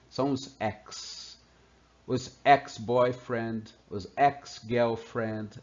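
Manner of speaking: ex said slowly and drawn out; slow letter demo. Shown as a demonstration. Teacher joking about exes, slow demo. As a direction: linger on ex each time. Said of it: "Esse é o som dessa letra".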